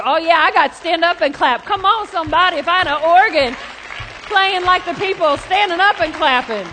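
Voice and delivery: loud, mock revival preacher; preaching at full force. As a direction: belt it out as if the organ played.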